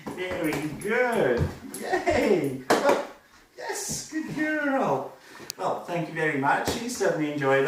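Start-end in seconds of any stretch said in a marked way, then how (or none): 0.1-4.1 high, slow, gushing praise for the dog; excited praise. Real, not acted. Trainer praising her dog after a fun trick, excited and high. 4.1-5.1 high-pitched praise. Real, not acted. Trainer rewarding her dog Zara, gushing and very high.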